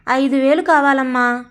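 usual speaking voice

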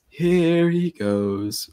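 high, slow, drawn-out sing-song; Jim Dale sing-song. Retold or imitated. Imitating Jim Dale as Ron mocking Harry, sing-song. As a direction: chant it lazily up high, stretching each word. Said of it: "like a singy, songy thing".

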